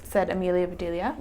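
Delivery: ordinary delivery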